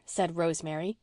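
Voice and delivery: ordinary delivery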